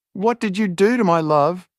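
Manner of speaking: high anguished scream; screaming in pain. A narrator's reading. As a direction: cry out in agony. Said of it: "Joseph kept screaming out in pain".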